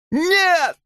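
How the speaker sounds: very high indignant shriek; furious cry. A narrator's reading. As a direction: cry out in outraged denial. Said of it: "вскричала Хемингуэй, яростно выпятив бюст". (яростный высокий вскрик)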